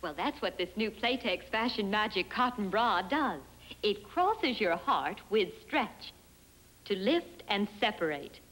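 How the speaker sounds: ordinary talk, nothing marked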